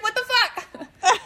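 high, slow, laughing outrage; stretched-out laughing what the fuck; mock disbelief. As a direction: drag it out in stunned disbelief. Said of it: "And he's like".

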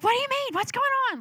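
very high, rapid-fire alarm; freaking-out sister. Retold or imitated. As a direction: imitate a panicked sister's squeal. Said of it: "Like, starts freaking out".

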